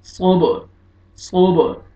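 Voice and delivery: सोब with heavy s and echoing final b; qalqala bounce. Shown as a demonstration. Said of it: "double sound आएगा". (सोब के ब पर डबल साउंड)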